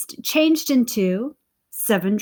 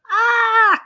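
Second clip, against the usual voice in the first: sudden high shriek as he's grabbed; startled high cry; cry of alarm; 12 semitones higher, 7 dB louder than usual. A narrator's reading. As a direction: yelp in panic. Giant grabs Jack mid-rant.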